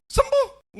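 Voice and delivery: high, loud burst of certainty; emphatic cry. Retold or imitated. Pastor assuring the young man his father will heal, loud cry. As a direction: fling the word out with conviction.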